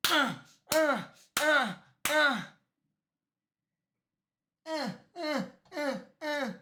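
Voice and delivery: short repeated effort grunts; acted grunts. Cop smacking the killer, short repeated grunts. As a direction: grunt sharply with each pretend blow. Imitates blows during interrogation.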